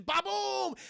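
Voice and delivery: high, drawn-out mock ba-boom; explosion noise. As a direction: boom it out like a cartoon blast. Imitates grenade launcher blast.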